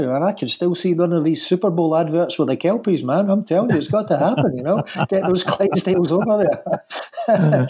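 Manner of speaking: high, animated joking pitch. Real, not acted. Sculptor kidding the interviewer about Super Bowl adverts, joking. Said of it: "joking aside".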